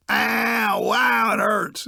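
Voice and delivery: high, exaggerated ow of pain, hammed-up; mock pain whine. Man at the office whining about his sore neck, hammed-up high moan. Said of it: "holding his neck going".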